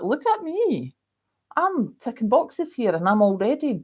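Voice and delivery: high, sing-out mock boast; high-pitched imitation of showing off; playful self-quote. Acting out her own quote.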